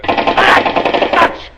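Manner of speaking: high panicked yell; panicked shout. Acted. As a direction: scream his name in panic.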